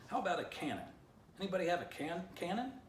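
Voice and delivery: high, rising, eager asks; Poo Poo pleading. A narrator's reading. Poo Poo begs the group for a cannon, rising high. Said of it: "Poo Poo asked".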